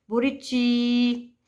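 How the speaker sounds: "buriti" slowly, holding the final "ti"; stress on final syllable. Shown as a demonstration. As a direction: stretch the last syllable of "buriti". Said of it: "sílaba pronunciada com mais força". ("buriti" devagar, alongando o "ti" final)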